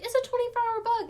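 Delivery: high-pitched mock reassurance; mimicking breezy dismissers. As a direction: parrot others' glib advice up high. Mimicking dismissive advice from others.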